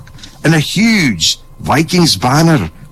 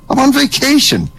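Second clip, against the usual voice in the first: high, put-upon complaint; indignant whine; 13 semitones higher than usual. Acted. Agent scolding the neighbor, high indignant whine. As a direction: whine that you are on vacation.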